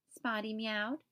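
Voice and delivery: plain reading voice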